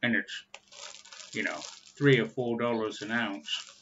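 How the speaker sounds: talking around pipe stem; speaking through clenched teeth; pipe in mouth. Real, not acted.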